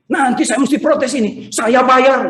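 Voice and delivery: high, indignant mock tirade; angry grumbling. Neighbour who overslept protesting missed garbage pickup, mock anger. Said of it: "dia marah-marah".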